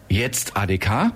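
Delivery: ordinary talk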